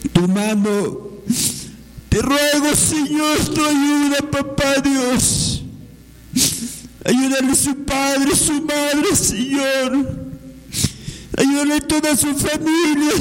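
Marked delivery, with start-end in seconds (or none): none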